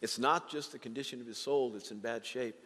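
normal talking voice

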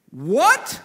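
sudden startled What? from God; God caught off guard, yelping in surprise; shocked What?. Pretend. Pastor mocks God being surprised, startled yelp. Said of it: "He didn't go".

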